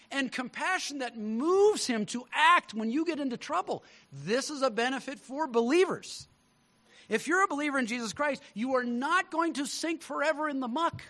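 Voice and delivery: high, loud, building preaching; preaching at full force. Acted. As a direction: lift the room with rising conviction.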